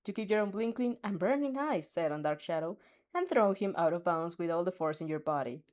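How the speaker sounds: ordinary delivery, nothing marked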